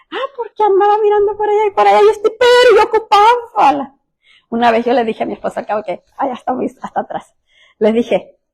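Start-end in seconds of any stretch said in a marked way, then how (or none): 0.1-3.9 put-on high-pitched, hurried young man making excuses; acted excuse in a raised, rushing voice; errand boy's excuse. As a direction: play the dawdling son explaining himself, pitched way up. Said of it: "Y el muchacho".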